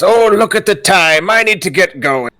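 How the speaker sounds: high, loud mock alarm; hammed-up excuse to make a quick exit; villain's sudden excuse. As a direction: exclaim it in a high, theatrical voice. Villain's excuse before vanishing.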